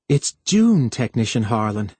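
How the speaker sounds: ordinary delivery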